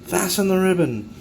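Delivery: a light mock call down from a cabin porch; playful shout to the ground; mock yell from the porch. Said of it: "and he yells".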